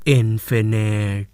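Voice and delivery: very slow, low, drawn-out reveal; dramatic slow answer. Acted. Boy giving classmates his answer, slow low dramatic reveal. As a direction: draw the word out low, like a grand verdict.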